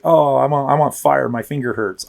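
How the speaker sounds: whiny caller. Retold or imitated. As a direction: overplay a caller with a tiny hurt. Mocking trivial caller.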